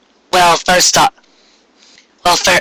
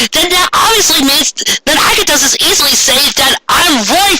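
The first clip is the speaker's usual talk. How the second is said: climbing much higher, the last words dragged out slowly; exasperated rant; 10 semitones higher, slower than usual. Real, not acted. Reader lists the story's plot holes, rising exasperated rant. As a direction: build the complaint until it breaks into disbelief.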